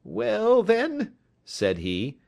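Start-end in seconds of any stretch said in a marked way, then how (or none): 0.0-1.1 high, tense voice; nervous little man. A narrator's reading. Little man hesitating, then giving an alias, nervously high. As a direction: give a false name uneasily.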